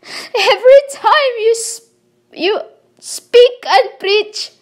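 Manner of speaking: real tearful outburst; crying. Real, not acted. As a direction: voice cracks with sobs as she accuses. Tearful accusation of humans.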